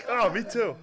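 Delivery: high, laughing voice; laughing mock reply. Husband replying to his wife, laughing and high. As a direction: chirp it through laughter. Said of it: "couple talking after shots".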